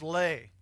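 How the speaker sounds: "lay" said slowly and clearly like the chip brand; syllable "lay" spelled out for the crowd. Shown as a demonstration. Pastor teaching the church to say "lay". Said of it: "Everyone say lay".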